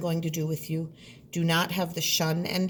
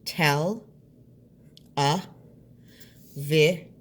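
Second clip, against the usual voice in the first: "Tel, a, vi" in separate beats; "Tel, a, vi" with pauses; syllable by syllable; 5 dB louder, slower than usual. Shown as a demonstration. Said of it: "You can hear those four beats".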